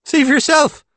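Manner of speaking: high, loud invitation; triumphant call. A narrator's reading. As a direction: call it out brightly to a child. Calling triumphantly to the child.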